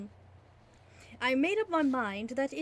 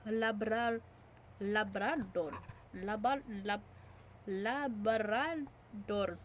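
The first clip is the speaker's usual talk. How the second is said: slow Labrador in a Spanish accent; 2 semitones lower, 5 dB quieter, slower than usual. Shown as a demonstration. Reader stumbling into Labrador in a Spanish accent. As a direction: stretch Labrador out, Spanish style. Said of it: "why am I saying it with a Spanish accent".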